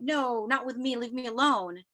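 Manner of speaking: usual speaking voice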